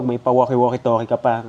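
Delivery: ordinary talk, nothing marked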